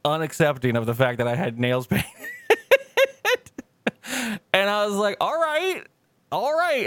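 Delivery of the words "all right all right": very high, strained all right; awkward mock resignation. Speaker cornered over painted nails, high strained all right. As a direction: squeak it out awkwardly.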